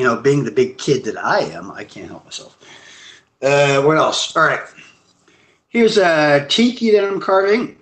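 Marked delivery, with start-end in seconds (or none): none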